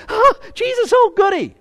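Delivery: high, squeaky mock delight; sarcastic sugary "oh goody" voice; mock gushing convert. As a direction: lampoon a gushy, shallow convert. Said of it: "bleary-eyed ... presentation going".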